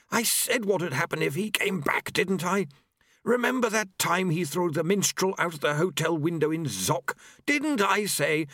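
high-pitched, peevish grumbling; complaining Famine. A narrator's reading. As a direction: whine on in a high, aggrieved voice.

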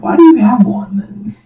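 high, loud disbelieving question; incredulous. Retold or imitated. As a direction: toss it up in disbelief. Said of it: "It's like".

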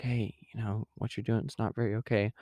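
soft, hesitant half-whisper; mock whisper. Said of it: "Just kinda whisper to him".

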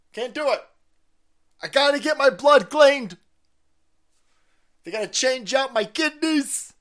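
put-on very high, loud whiny old man; mock Biden. Biden refusing to fight on weekends, high whine.

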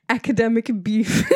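loud giggles bursting through the words; cracking up. Real, not acted. Speaker announcing the topic to listeners, laughing. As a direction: barely get the topic out for laughing.

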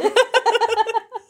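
squealing giggle; high-pitched burst of laughter. Real, not acted. Laughing at bed joke.

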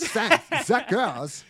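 hammed-up Japanese-style English; Japanese-accented English. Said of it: "they make him sound like".

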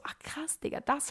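breathless whispered wow; whispered amazement. Retold or imitated. To herself while rehearing old episodes, a stunned whisper. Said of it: "Und dann bin ich so".

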